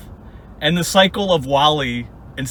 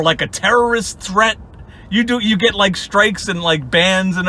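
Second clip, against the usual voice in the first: ordinary talk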